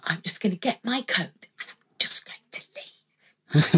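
put-on whispering the old thought to herself; a breathless, unvoiced self-talk as she plans to slip out; retold whisper. Singer recalling her audition nerves, in a whisper. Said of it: "And I'd sat there and gone".

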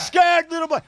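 high, mocking singsong taunt. Taunting a laughing friend in a high mocking voice. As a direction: jeer it at him in a put-on voice. Teasing chant, high put-on voice.

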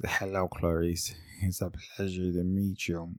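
mock-creepy, low, hushed, slow greeting; Jim Carrey. Spoofing the killer's greeting in a comedy film, low and hushed. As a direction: imitate a comic doing a soft villain voice. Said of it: "when Jim Carrey put the chicken skin".

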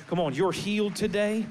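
high, loud altar-call preaching; a pastor praying healing over the congregation, building; impassioned preaching. Acted. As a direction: lift the room to its feet. Preaching at full force over music.

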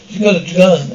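ordinary talk

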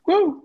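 high, loud "woo"; short, soaring catchphrase yell; imitated wrestler whoop. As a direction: let out a high showman's whoop. Mimics wrestler's whoop as doors close.

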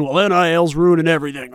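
mock old boomer complaining about the new era. Host mocking an old boomer's gripe about NIL, put-on voice. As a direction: put on a cranky old-timer voice for one line. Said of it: "the old boomer guy saying".

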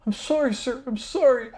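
high, pleading apology; begging servant. Acted. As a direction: cower and beg for mercy. GM voices beaten NPC begging.

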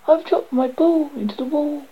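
high-pitched storybook wail; wailing princess. A narrator's reading. Princess to frog about her lost ball, wailing high. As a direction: lament it like a distraught princess. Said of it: "she wailed".

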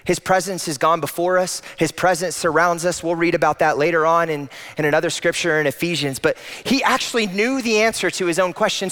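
ordinary delivery

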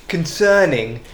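usual speaking voice

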